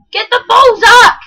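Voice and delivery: high, loud desperate shout at a teammate; frantic yell. Real, not acted. Yelling to teammate Zach to get the ball, high and loud. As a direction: scream for Zach to grab the ball.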